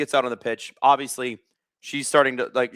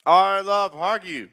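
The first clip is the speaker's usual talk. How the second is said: high, slow, drawn-out hype call; hyped opener; 6 semitones higher, much slower than usual. Acted. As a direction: sing out the love of hockey. Opening the stream, hyped.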